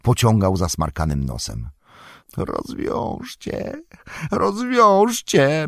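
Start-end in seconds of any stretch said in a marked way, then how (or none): 2.4-3.8 high, slow, whispered creature plea; mumbled whisper. A narrator's reading. Gollum begs his captors, whispered and slow. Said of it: "bełkotał". 4.3-5.7 high, slow, voiced creature plea; mumbled plea. A narrator's reading. Gollum begs his captors, high and slow. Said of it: "bełkotał".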